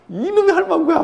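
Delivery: put-on high, loud grumpy old husband scolding his wife; hammed-up comic yell at the wife; mock angry outburst. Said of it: "화를 내는 거예요".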